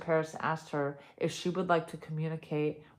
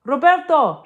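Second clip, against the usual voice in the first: a loud, high scream of the name; 9 semitones higher, 15 dB louder than usual. Acted. Grandmother screaming her dead love's name. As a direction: shriek his name in shock. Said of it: "she screamed".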